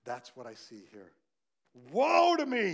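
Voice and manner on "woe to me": high, loud, wailing "woe to me"; the prophet's loud, high lament; anguished cry. Acted. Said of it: "he cried".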